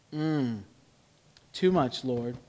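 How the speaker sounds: sheepish confession. Pretend. [name] confessing to God, sheepish and soft. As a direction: mumble it like a caught kid.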